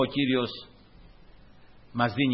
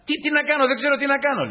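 high, louder helpless cry; exasperated; 12 semitones higher, 5 dB louder, much faster than usual. Retold or imitated. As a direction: throw up your hands, pitched high and loud. Retold helpless outburst with colleague.

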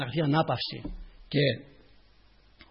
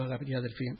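ordinary delivery

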